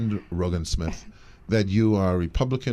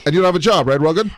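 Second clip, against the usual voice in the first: higher and louder, a hammed-up Trump; mock Trump; 6 semitones higher, 7 dB louder, faster than usual. Playing Trump needling an unemployed voter, louder. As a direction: play Trump pressing the voter. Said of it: "now I'm Donald Trump".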